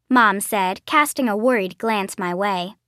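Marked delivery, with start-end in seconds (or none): none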